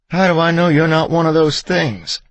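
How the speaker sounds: high, loud frightened challenge; frantic, high-pitched yell; panicked shriek. A narrator's reading. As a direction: scream it in terrified suspicion. Terrified armed wife shouting at husband.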